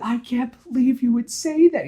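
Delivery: high, loud upset yell. Retold or imitated. Gwen yells at Peter over his joke, high loud. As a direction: yell it at him, hurt and high. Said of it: "Gwen is yelling".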